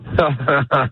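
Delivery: short forced chuckle; fake laugh. Shown as a demonstration. Comic shows the interviewer a fake laugh on request. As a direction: put on a brief fake laugh. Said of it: "let me hear your fake laugh".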